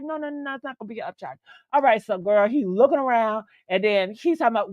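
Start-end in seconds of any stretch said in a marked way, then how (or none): 0.0-1.4 soft, quickly soothing imitation; hushed reassurance. Man reassuring customer about an upcharge, soft imitation. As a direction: play down the worry very quietly.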